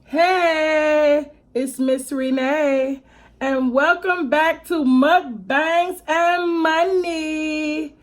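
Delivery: loud, drawn-out excited welcome; show host intro. Acted. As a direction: greet the viewers like a TV host. Channel intro greeting.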